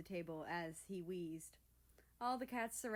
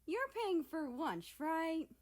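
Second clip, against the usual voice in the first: smug; 9 semitones higher, 5 dB louder than usual. Acted. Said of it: "smug smile".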